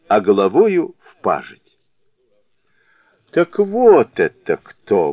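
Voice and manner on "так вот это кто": высокий медленный возглас догадки; ахни, узнав врага; изумлённый возглас. A narrator's reading. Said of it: "воскликнул Джубадзе". (изумлённое восклицание)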